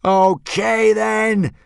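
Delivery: very high, very slow, loud scream; boy screaming up at Thor. A narrator's reading. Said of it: "screamed Hiccup".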